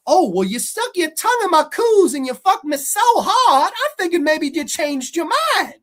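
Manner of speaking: loud, high, hammed-up movie dialogue. Critic demonstrating her acting in it, hammed-up mocking imitation. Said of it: "This is some of her acting in it".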